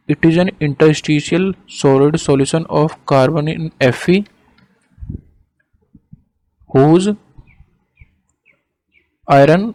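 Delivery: slow, measured dictation; word by word, with pauses for writing. Real, not acted. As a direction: dictate slowly for note-taking. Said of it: "देखो इसकी definition दोबारा से मैं बोल रहा हूँ".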